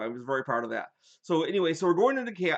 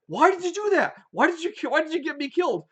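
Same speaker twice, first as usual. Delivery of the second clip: high-pitched, louder indignant whining; whiny protest; 12 semitones higher, 5 dB louder than usual. Retold or imitated. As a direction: complain like a betrayed player, pitched way up. Dead player protests to Greg.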